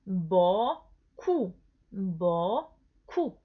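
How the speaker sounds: "bo" and "ku" as separate syllables; repeat-after-me drill, isolated "bo", "ku". Shown as a demonstration. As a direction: punch "bo" loud, pause, then a short "ku". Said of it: "Repita conmigo".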